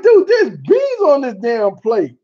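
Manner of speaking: high, outraged disbelief at the plate; shocked disbelief. Retold or imitated. To the Brit over a plate with beans, shocked and high. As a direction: stare at beans for breakfast, appalled. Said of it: "I'm like".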